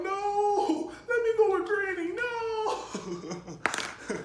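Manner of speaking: high, crying, half-laughing whine; crying plea. Retold or imitated. A kid begging to go with Granny, crying whine. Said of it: "I'm already crying".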